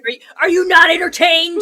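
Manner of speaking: Gladiator-style arena roar; barbarian. Pretend. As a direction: bellow it like a gladiator. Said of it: "This is entertainment right here".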